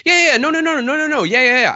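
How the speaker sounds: high, loud run of yeahs and nos; defensive, like in trouble. Shown as a demonstration. Host mimicking their own habit when in trouble, high and defensive. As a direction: rattle them off as if caught out. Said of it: "I sound like more like I'm in trouble".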